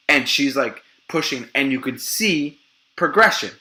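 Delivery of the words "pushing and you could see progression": usual speaking voice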